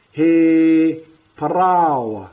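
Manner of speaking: "He parawa" stretched slowly, every sound given; slow, deliberate "He parawa" with all sounds; careful enunciation. Shown as a demonstration. Teacher demonstrating pronunciation, very slow. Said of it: "get all the sounds in there".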